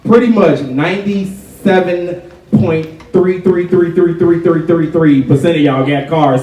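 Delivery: usual speaking voice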